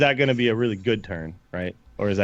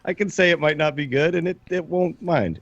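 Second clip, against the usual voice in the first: exasperated reassurance; 6 semitones higher than usual. Retold or imitated. Arguing back at card lovers.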